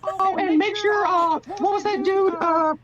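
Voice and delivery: very high cartoon boss giving orders; squeaky, offhand executive firing someone; Mick.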